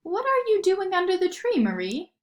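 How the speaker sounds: high, surprised motherly call. A narrator's reading. Mother finding Marie under the tree in the morning, surprised. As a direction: find her under the tree. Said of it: "said her mother".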